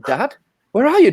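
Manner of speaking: put-on high, squeaky child leaf calling out; little leaf calling. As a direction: pipe it up like a small leaf crying for dad. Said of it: "the family of leaves around him start going".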